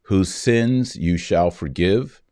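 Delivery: ordinary talk, nothing marked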